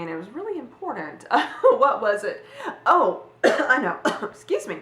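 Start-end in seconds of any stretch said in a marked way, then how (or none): 2.9-4.8 real cough breaking into the words; talking through a sudden choke; choked up, coughing. Real, not acted. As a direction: cough and excuse yourself through a catch in the throat. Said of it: "got choked up there".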